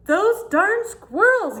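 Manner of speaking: put-on very high, slow, loud old-man shout; grumpy old man yell. Old man furious at the squirrels, high slow shout. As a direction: bellow it out, dragging each word. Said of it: "yelled Old Man Fuquire".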